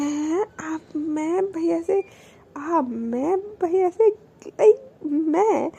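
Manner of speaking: slow, halting, repeating मैं भैया से; imitating a tongue-tied stammer. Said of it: "वो थोड़ा अटकटाती है, लड़खड़ाती है उसकी ज़ुबान". (अटकते-लड़खड़ाते, धीमे शब्द)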